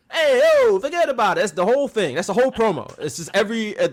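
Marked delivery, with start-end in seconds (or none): 0.1-1.4 put-on much higher, louder New York Italian accent on hey yo; loud mocking New York Italian accent. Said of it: "Italian stereotype".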